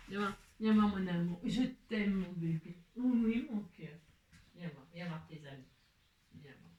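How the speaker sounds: soft, fading, tender calling; tender to the cat. Real, not acted. Calling her cat over, soft tender coaxing. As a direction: coax the cat over gently, almost breathing it.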